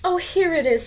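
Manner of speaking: Wendy spots the shadow and calls out; excited Wendy. Acted.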